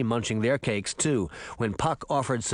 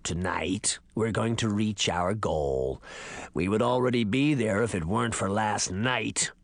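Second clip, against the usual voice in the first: low, creaky ancient man; dry, very old voice, pitched down; 4 semitones lower than usual. Acted. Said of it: "voice of a man who had been alive a thousand years".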